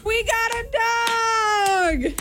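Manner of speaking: high, drawn-out excited echo; excited announcement. Real, not acted. Host echoing her husband's news about their new dog, excited.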